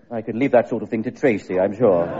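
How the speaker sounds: usual speaking voice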